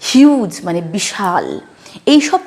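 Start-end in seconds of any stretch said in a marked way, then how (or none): 0.1-0.5 "হিউজ" with a drawn yoo; U as "you". Shown as a demonstration. Teacher giving an example word, "হিউজ" with long yoo. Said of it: "ইউ ভাবেই উচ্চারণ করছি".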